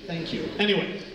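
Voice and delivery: usual speaking voice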